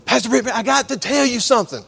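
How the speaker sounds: can't wait to tell him; excited girl. Retold or imitated. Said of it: "all excited and stuff".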